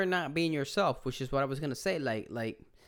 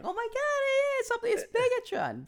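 put-on very high squeal of a thrilled child; excited kid. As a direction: squeak it like a little boy seeing Megatron. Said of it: "he's like".

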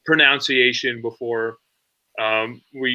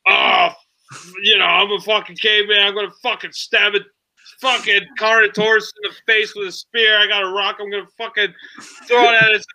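caveman voice; 8 semitones higher than usual. Retold or imitated. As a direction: bellow it like a dumb caveman. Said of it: "And this is like".

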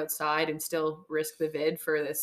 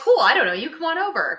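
carefree invite; 9 semitones higher, 10 dB louder than usual. Retold or imitated. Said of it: "people are like".

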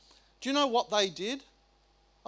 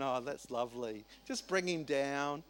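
sarcastic calm; 7 semitones lower, 9 dB quieter than usual. Retold or imitated. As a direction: pretend to be serenely fine with it. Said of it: "You're going".

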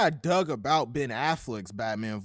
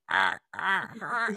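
imitating a bad laugh; slower than usual. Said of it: "he sounds like if a dying parrot".

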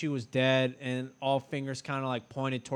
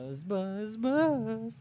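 put-on high creepy old man buzz; Herbert voice; 9 semitones higher, much slower than usual. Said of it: "Oh, he'd do the Herbert voice?".

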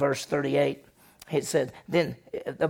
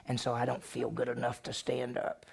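much softer, trailing excuse; 3 semitones lower, 9 dB quieter than usual. Retold or imitated. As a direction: let the excuse fade to a murmur. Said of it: "What did she say?".

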